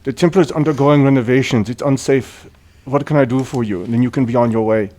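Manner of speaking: officious, in-character dismissal; oblivious priest. Acted. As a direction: repeat a set speech, ignoring the threat. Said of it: "doesn't even acknowledge the blade and just says".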